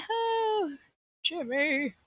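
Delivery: real startled yell at Jimmy; alarmed outcry. Real, not acted. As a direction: cry out at the loss, loud and high. Speaker notes gone, real alarm.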